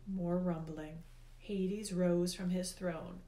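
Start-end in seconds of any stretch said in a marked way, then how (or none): none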